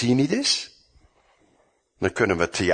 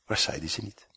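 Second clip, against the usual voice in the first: usual speaking voice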